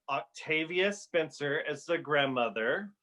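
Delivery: ordinary talk, nothing marked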